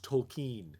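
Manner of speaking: "Tolkien" with a long ee, tol-KEEN; correct pronunciation. Shown as a demonstration. Correcting the co-hosts on the name, "Tolkien" as tol-keen. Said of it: "actually supposed to be pronounced".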